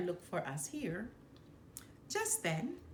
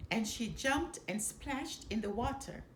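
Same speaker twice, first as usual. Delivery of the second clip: plain reading voice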